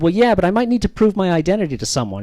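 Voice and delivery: normal talking voice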